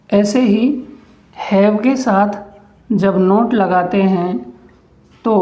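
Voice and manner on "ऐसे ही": ordinary talk, nothing marked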